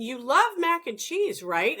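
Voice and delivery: 1 coaxing question. Retold or imitated. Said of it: "But, I mean".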